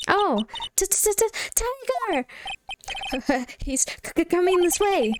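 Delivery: high, stuttering mock terror; panicked stammer. Sidekick warning Nick of a tiger, panicked stammer. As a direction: trip over the words in a hammed-up fright.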